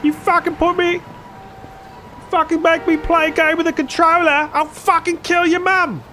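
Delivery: yelling Az impression. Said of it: "I'm, like, yelling".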